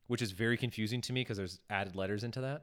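ordinary delivery

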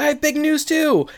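high-pitched eager echo; excited announcement. Retold or imitated. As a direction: chime in thrilled, pitch way up.

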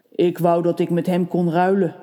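a hint of the tailor; lightly voiced tailor wishing; tailor's thought. A narrator's reading. The tailor wishing he could swap with the crow, lightly voiced. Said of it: "klonk de stem van de man".

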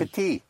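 French "Petit" hitting the final EE, T silent; hit the ending. Shown as a demonstration. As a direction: land on the TI of "Petit" with no closing T. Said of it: "Hit the ending".

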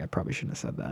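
whispering, under the breath; whispered aside. Retold or imitated. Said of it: "I was like".

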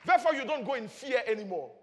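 normal talking voice

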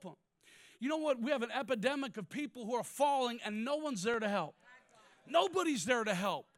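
fiery preacher cadence; preaching at full force. Acted. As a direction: drive it home to the church.

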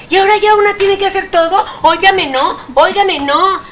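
indignant. Real, not acted. Outraged complaint about self-checkout.

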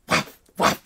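two airy mouth-made pops; voiceless shot noises; imitated paintball shots. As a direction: puff out two gun shots, spaced apart.

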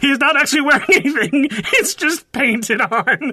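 laughing through the words. Real, not acted. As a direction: giggle while saying it.

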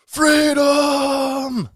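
long, loud, high battle cry; William Wallace shout. Acted. William Wallace shouting to the sky and the stars, full shout. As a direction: bellow it out, stretched and high. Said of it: "there's William Wallace, uh, shouting freedom".

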